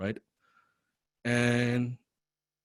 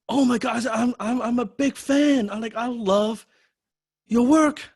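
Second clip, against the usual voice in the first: gushing fan; 13 semitones higher, 5 dB louder than usual. Retold or imitated. Young fan gushing to his favourite writer, high starstruck squeal. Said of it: "so loud in the back".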